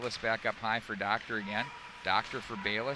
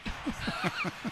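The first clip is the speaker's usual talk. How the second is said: high laugh; 10 semitones higher, 4 dB quieter than usual. Real, not acted. Laughing at partner.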